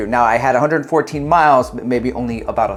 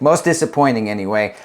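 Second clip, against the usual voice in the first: normal talking voice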